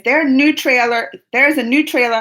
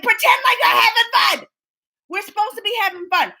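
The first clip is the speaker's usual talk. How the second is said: high, strained mock yell; hammed-up high-pitched scolding; imitating her own yelling; 9 semitones higher than usual. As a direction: re-enact a parent yelling at kids. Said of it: "I was yelling at them like that".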